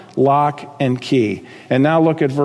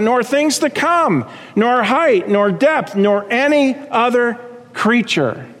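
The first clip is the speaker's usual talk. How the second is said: raised, ringing pulpit cadence; full-force preaching; 5 semitones higher than usual. Acted. Preacher lifting the congregation with Paul's promise, raised and ringing.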